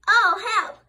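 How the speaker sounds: high loud cry for help. Acted. Daddy stuck in mud, loud mock cry. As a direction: yelp in mock panic. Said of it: "cried Daddy squirrel".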